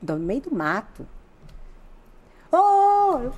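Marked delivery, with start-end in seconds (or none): 2.5-3.3 high, loud hey hey; calling out. Retold or imitated. The guy calls again to get her attention, loud high call.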